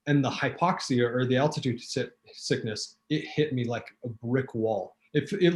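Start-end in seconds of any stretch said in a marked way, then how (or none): none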